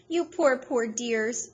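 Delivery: high tearful pity for dogs; pitying cry. Acted. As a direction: cry over them tenderly. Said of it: "she cried".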